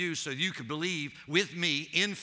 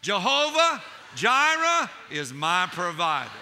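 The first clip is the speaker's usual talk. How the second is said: loud shouted proclamation; shouted declaration; 6 dB louder than usual. Real, not acted. Preacher leading the saints in a shouted declaration. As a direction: shout it out with the congregation. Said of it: "Can you say it with me?".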